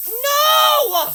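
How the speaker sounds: sharp high shout of alarm; real shout. Real, not acted. Shouting at the escaping snake, alarmed yell. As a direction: yell as it gets away.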